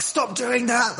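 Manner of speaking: high-pitched mock scolding, held back in volume; mock shout. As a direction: imitate an owner telling off a dog. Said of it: "they shout at the dog".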